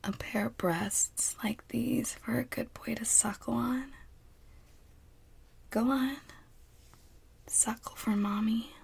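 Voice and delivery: tender coaxing whisper. Acted. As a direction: coax gently in a whisper.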